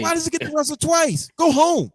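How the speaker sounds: indignant fan shouting at the TV; angry shout. Retold or imitated. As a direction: yell it like a furious fan. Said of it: "I was pissed".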